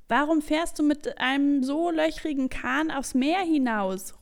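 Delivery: lauter Zuruf übers Wasser, etwas höher; zurufend. Acted. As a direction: ruf es hinüber zum Boot. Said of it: "ruft ihr ihm zu". (laut hinüberrufen)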